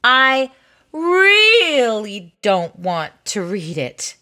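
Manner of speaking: "really" stretched long and pushed; emphatic refusal. Acted. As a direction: lean hard and slowly on "really". Reluctant reader insists on refusal.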